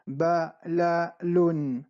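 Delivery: balalun drawn out very slowly; slow model word. Shown as a demonstration. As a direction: stretch balalun for learners. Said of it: "La última".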